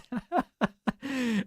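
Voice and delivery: high, light burst of laughter; amused laugh. Real, not acted. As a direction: laugh at your own remark. Amused by his dark web remark.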